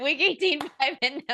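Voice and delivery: laughing through a bold prediction; barely straight-faced. Real, not acted. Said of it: "can't even say it with a straight face".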